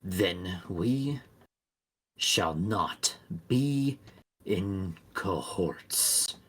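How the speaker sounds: slow, soft creature voice. Acted. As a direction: let each word hang, low and slow. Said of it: "It looks to you and says".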